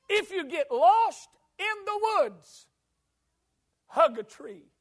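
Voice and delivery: high, loud, slow preacher's cry; preaching climax. Acted. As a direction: build to a ringing preached climax. Sermon climax, preacher rising.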